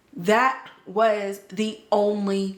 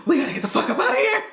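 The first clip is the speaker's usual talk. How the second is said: fast, higher, laughing through the line; quick panicked line broken by laughter; laughing urgent quote; 6 semitones higher, much faster than usual. Retold or imitated. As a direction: rush it out while laughing. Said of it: "Boyd was like".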